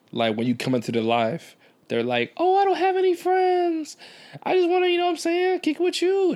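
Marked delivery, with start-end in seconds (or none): 2.4-6.3 man doing a woman's voice. Retold or imitated. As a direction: pitch way up into a girl's voice. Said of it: "That's my girl voice".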